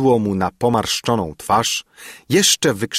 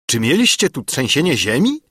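a higher, louder sudden outburst; an uncle's sharp, vehement question; vehement exclamation; 6 semitones higher, 3 dB louder than usual. A narrator's reading. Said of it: "zawołał stryj gwałtownie".

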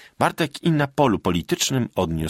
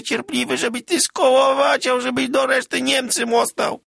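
much higher, louder tearful wail; sobbing octave higher; 4 dB louder than usual. A narrator's reading. Magda weeping over her changed husband, high sobbing wail. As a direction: cry it out near singing. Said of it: "podniosła głos o oktawę wyżej".

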